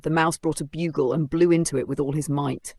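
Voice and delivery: plain reading voice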